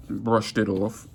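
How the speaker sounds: normal talking voice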